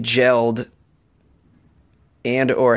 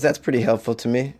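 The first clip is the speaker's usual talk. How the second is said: ordinary talk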